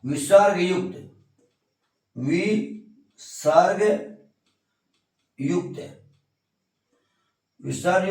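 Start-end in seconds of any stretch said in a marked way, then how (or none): 2.2-6.0 slow dictation. Shown as a demonstration. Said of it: "dictating the term".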